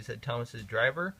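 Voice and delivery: ordinary delivery, nothing marked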